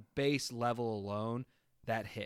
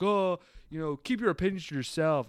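mocking quote; 5 semitones higher, 4 dB louder than usual. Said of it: "everyone's bashing criticism, like".

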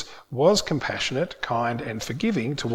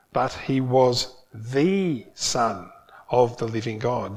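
very slow, "the Son" weighted against "a son"; stress on the; 3 semitones lower, slower than usual. Shown as a demonstration. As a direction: hit "the" before "Son", unhurried. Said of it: "used the definite article to specify".

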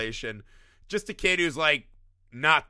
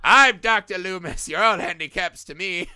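louder, pompous boast; hammed-up self-important doctor; mock arrogant Loomis; 4 semitones higher, 7 dB louder than usual. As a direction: puff up like a smug doctor.

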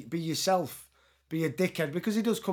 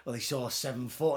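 normal talking voice

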